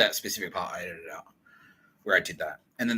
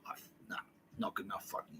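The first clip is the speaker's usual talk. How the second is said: hushed, breathy brush-off; mock whispered dismissal; whispered rejection; 14 dB quieter, faster than usual. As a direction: whisper the put-down coldly. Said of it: "I was like".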